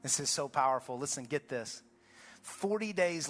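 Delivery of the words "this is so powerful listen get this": normal talking voice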